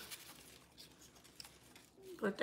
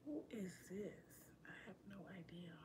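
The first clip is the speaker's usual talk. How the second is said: unvoiced, much softer whispered aside; 4 semitones lower, 25 dB quieter, much faster than usual. Real, not acted. Whispering to someone in the room, not viewers. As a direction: whisper it away from the mic.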